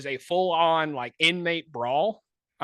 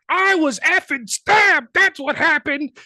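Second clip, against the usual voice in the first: much higher, louder, slow furious yell; angry yell; 13 semitones higher, 8 dB louder, slower than usual. Retold or imitated. Stabbed inmate yelling at the officer, a furious yell. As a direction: spit it out, outraged and loud. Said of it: "screaming and yelling".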